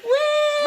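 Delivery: high, drawn-out woo; mock party cheer. Partygoers loving the explosion, in a high mock cheer. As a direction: imitate a cheering beach crowd. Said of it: "They're like".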